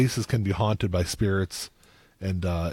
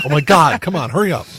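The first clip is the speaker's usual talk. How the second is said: impatient shadow person; 5 semitones higher, 7 dB louder than usual. Pretend.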